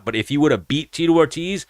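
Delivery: ordinary talk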